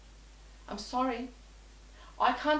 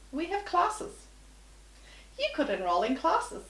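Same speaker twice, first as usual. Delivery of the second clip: patronising agent; 8 semitones higher than usual. Retold or imitated. Agent's patronising offer voiced.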